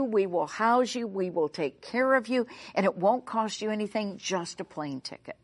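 usual speaking voice

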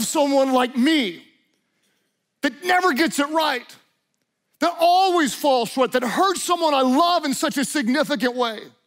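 high, building cry of shame; acted anguish rising from the regret; anguished self-reproach. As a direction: plead it out, pitch climbing with despair.

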